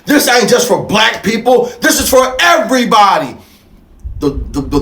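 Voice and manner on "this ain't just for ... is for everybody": normal talking voice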